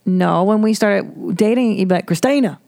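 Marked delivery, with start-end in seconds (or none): 2.1-2.6 mock Carolina accent. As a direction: imitate a North Carolina Southerner calling a name. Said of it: "You had that Carolina thing".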